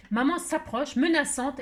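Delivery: ordinary delivery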